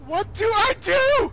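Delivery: high slow drawn-out shriek; terrified scream. Real, not acted. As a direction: plead in pure panic. Monster near.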